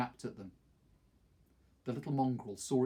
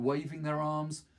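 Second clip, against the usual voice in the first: ordinary delivery, nothing marked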